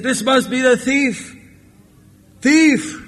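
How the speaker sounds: high-pitched accusation rising to a cry; accusing cry. Acted. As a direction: point out the culprit in a high voice. Accuser raises alarm in story.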